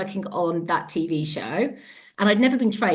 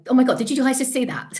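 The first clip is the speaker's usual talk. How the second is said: higher, louder and fast, bursting with wonder; excitable puppy; 7 semitones higher, 5 dB louder, faster than usual. Retold or imitated. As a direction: blurt it like an overexcited puppy. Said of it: "like this excitable puppy".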